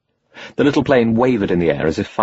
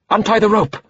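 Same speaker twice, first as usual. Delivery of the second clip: shouted order; 12 semitones higher than usual. Acted. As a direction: yell it over roaring wind. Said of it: "turned round and shouted at him".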